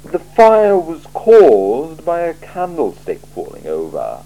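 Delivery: a cool ruler weighing every word; icy, deliberate interrogator; Lord Vetinari. Acted. Vetinari questions Vimes.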